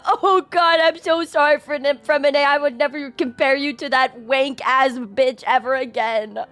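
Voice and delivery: ordinary talk, nothing marked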